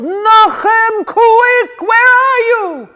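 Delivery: high, loud alarmed yell; loud high-pitched shout for Nochem. Acted. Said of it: "he let out a yell".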